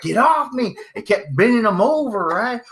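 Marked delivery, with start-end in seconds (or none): 0.0-0.8 mock yell. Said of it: "he was just like".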